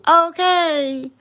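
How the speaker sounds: loud, high drawn-out okay; Unbiased's okay. Retold or imitated. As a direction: belt out her signature okay. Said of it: "That's her saying too".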